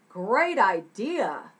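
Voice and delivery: loud, drawn-out delight; slow, swooping enthusiastic approval; excited. Acted. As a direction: agree with gusto. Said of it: "[name]'s excited about it".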